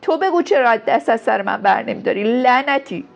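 loud, high-pitched angry retort; heated, exasperated eruption; furious outburst. A narrator's reading. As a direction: roar back at him, accusing. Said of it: "یلدا به خروش آمد و گفت".

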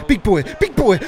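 building shout. Real, not acted. Player running at goal.

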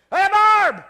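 very loud, high holler; shouted call to wife. Acted. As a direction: yell for her across the house. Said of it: "I don't have to say".

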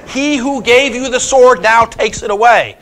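loud declamation. Retold or imitated. Brunhilde tells Sigmund the sword is forfeit, in a loud voice. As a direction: declaim it slowly in a loud, imperious quoted voice. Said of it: "in a loud voice".

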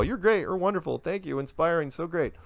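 high-pitched mock adoring fan; imitated gushing praise. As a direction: rattle off compliments in a high, gushy imitation. Mimics gushing fan comments.

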